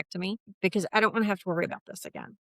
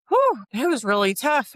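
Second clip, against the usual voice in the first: a high, loud exhale of relief; mock-exhausted whew of relief; relieved whew; 6 semitones higher, 6 dB louder than usual. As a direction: let the relief burst out after a tough day. Relief after a hard day.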